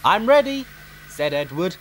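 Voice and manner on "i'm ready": high eager little engine. A narrator's reading. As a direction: chirp it out, bright and ready. Edward eager to push.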